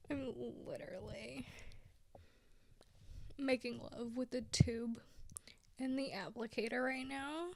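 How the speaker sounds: very soft breathy ASMR murmur; mock-sultry ASMR whisper. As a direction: whisper close to the mic like ASMR.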